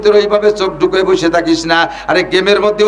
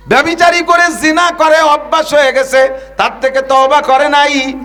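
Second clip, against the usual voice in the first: full-force preaching; 8 semitones higher than usual. Real, not acted. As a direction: preach it out at the top of the voice.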